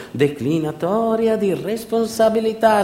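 high, slow, pompous courtroom voice; mock official jargon. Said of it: "Era una delle frasi che ripetevano durante il processo".

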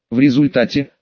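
ordinary delivery, nothing marked

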